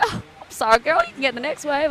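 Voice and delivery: uncle apologising. Retold or imitated. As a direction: back down and offer the wave. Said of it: "And he's like".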